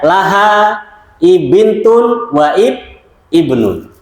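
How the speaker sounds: drawn-out, word by word Arabic; slow Arabic reading. Shown as a demonstration. Teacher reading the example sentence to the class, slow and drawn out. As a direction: spell the Arabic out slowly for the students.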